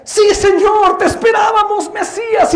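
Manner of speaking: very high, loud acclamation; exultant cry. Acted. Church hailing the long-awaited Messiah, exultant shout.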